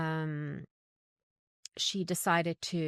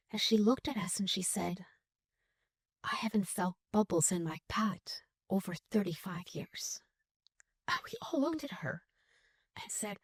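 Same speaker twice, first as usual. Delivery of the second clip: emotional whisper; 2 semitones higher than usual. Real, not acted. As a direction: voice dropping to a hushed, moved whisper. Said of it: "I'm gonna get emotional".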